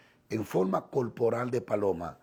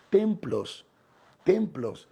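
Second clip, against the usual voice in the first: each "templos" cried out higher and louder; the word "templos" twice, raised and forceful; emphatic repetition; 7 semitones higher, 4 dB louder, much slower than usual. Real, not acted. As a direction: ring out "templos, templos" like a pulpit call. Preacher repeats word for emphasis.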